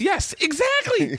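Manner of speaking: high, slow exaggerated yes; emphatic agreement. Real, not acted. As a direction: lean hard into the agreement.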